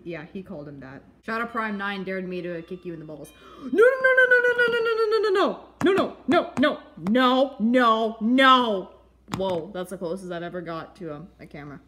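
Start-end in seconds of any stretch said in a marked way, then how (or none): 3.6-8.9 high frantic pleading no; loud high panicked pleading; panicked no's. Acted. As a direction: gasp and beg no over and over. Begs not to be kicked.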